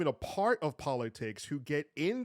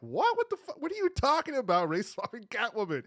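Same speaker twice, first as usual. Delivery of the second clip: higher, disbelieving outburst; incredulous reaction; 9 semitones higher than usual. Retold or imitated. Retelling own reaction to the rant, high and incredulous. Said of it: "I'm like".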